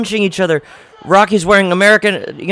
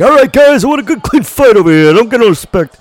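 higher, louder put-on old referee voice; 5 semitones higher, 5 dB louder, faster than usual. Mocking the old referee in the ring, hammed-up put-on voice. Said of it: "just being like".

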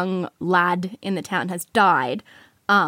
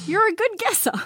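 Ned Kelly; 12 semitones higher, 3 dB louder than usual. Acted. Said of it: "every time Ned Kelly speaks".

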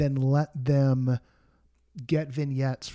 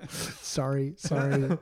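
apologizing too much; slower than usual. Real, not acted. Repeated laughing apology after a joke.

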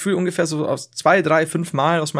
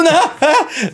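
startled confusion; 13 semitones higher, 6 dB louder than usual. Retold or imitated. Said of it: "Und du bist so".

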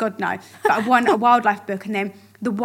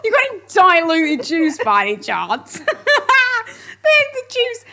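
laughing; 10 semitones higher, 5 dB louder than usual. Pretend. Mock posh request, laughing.

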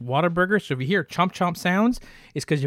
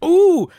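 warm-up ooh; 13 semitones higher, 10 dB louder than usual. Acted. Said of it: "your Sam Elliott impression".

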